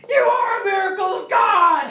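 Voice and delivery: high, drawn-out tearful holler; heckler's shout. Retold or imitated. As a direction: holler it slowly across a car park. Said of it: "heckle out".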